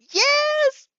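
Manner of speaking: high, loud excited squeal. Acted. Mina jumping in with an excited high squeal. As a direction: squeal yes with glee. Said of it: "she squeals".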